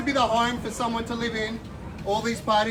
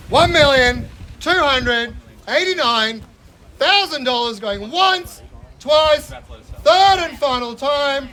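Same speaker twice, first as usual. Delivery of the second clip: slow, loud, higher final calls; auctioneer closing shout; 4 semitones higher, 8 dB louder, slower than usual. Real, not acted. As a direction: drive the sale home, shouting.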